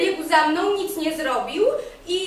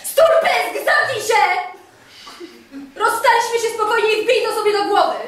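loud, higher snap of shut up; furious shout; 5 semitones higher, 7 dB louder than usual. Acted. Woman shouts at her ex to shut up, furious and loud. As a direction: order him to hammer it into his head.

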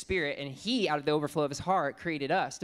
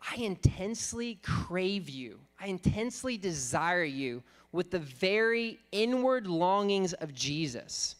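higher, slow, voiced as Paul's longing; Paul's intense longing; 4 semitones higher, slower than usual. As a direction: speak Paul's words as a slow, higher plea of craving. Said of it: "what Paul is essentially saying is he's saying".

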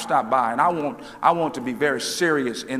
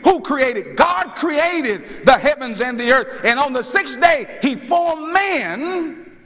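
preaching; 9 semitones higher, 4 dB louder than usual. Real, not acted. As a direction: preach it at full force, stretching the big words. Preaches at full force.

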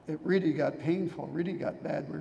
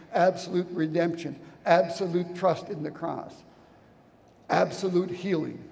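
louder, rolling preacher cadence driving each absolute home; full-force preaching; 7 dB louder than usual. Acted. Preacher declaring scripture to the congregation, loud and fervent. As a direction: proclaim it like a revival sermon.

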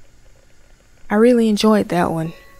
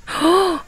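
sharp gasp; 11 semitones higher than usual. Real, not acted. Jump scare in horror game.

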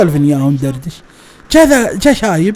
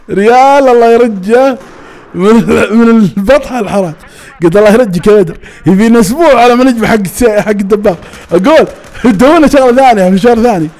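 louder, animated grumbling; mock indignation; 4 semitones higher, 4 dB louder than usual. Complaining to Badr about one-riyal fares, mock indignant. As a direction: protest in a raised, exasperated voice.

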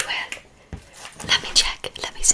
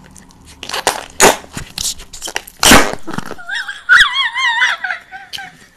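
a burst of real laughter breaking the whisper; laughing; 11 dB louder than usual. Real, not acted. ASMR host cracking up at her own lunchtime joke, laughing out loud. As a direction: let a loud laugh escape.

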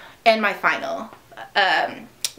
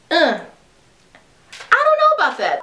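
high skeptical hedge; mock hesitant; 6 semitones higher, faster than usual. Vlogger imagining Anastasia declining, high skeptical. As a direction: back off with a high doubtful voice. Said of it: "she could have easily have said".

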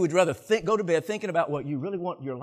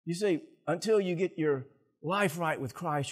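ordinary delivery

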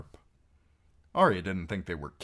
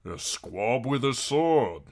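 slow, higher, louder amused soldier; amused big man; 6 semitones higher, 6 dB louder, slower than usual. A narrator's reading. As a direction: draw it out in mocking amusement. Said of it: "The big man laughed".

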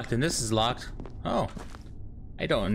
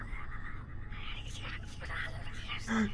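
sharp frightened whispered gasp; scared gasp; 10 semitones higher, 11 dB quieter than usual. Real, not acted. Sudden scare in horror game.

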